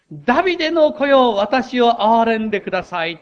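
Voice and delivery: begging the passing crowd, loud and high; retold cry for mercy. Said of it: "と叫んだんです".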